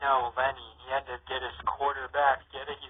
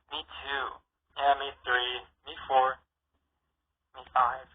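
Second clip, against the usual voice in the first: usual speaking voice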